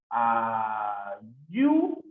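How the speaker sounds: "Ayu-" held long, swooping up on YU; syllable separation. Shown as a demonstration. Teacher sounding out ayúdalo, "Ayu-" in long syllables. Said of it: "Aquí es el, sería".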